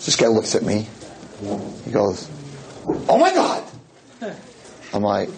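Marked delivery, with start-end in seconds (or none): 3.1-3.6 high-pitched startled outburst; acted shock, pitch leaping up. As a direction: imitate a stunned man's gasping exclamation. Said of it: "so the guy's shocked".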